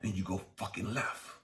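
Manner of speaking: usual speaking voice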